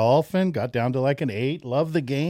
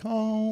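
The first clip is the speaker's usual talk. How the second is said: long, level, higher-pitched chanted om; meditative om; 9 semitones higher, 3 dB quieter than usual. Retold or imitated. Golfer suddenly zenned out without a ball, chanting. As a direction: hold one steady note like a mock meditator. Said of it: "you're just like".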